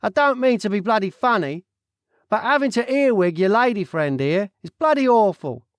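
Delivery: nosy Cockney stranger butting in; loud, cheeky Cockney voice; Cockney accent. Acted. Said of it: "and he turned to Dustin and said".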